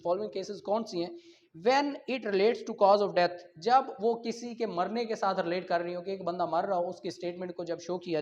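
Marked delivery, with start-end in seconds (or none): 1.7-3.3 loud, slow dictation of legal text; slow statute reading. Acted. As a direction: spell out each clause for students to copy. Reads the statute clause.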